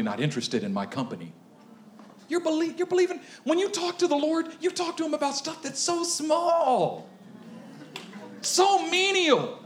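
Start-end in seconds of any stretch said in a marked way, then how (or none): 2.3-9.6 preaching. Acted. Pastor preaching to the church, building in force. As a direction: preach it to the congregation.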